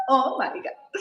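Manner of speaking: Oh my God laughed out, half crying; laughing disbelief. Retold or imitated. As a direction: laugh through it, overwhelmed. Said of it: "Y yo". (riendo incrédula)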